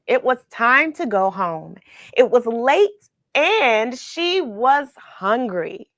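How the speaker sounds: plain reading voice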